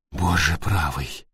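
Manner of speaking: low, slow, hushed shock; appalled words let out on a breath. A narrator's reading. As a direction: exhale it softly in horror. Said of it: "выдохнула Йенни".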